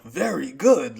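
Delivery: loud swooping mock announcement; imitating bracelet's yell. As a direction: yell it out like a talking gadget. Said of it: "the bracelet just, like, yells out".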